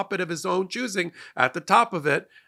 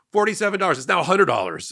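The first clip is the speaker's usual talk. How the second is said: ordinary talk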